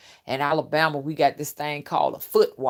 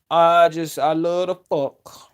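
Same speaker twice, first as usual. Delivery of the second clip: mock pastor; 6 dB louder, slower than usual. Comic voices the cheating pastor.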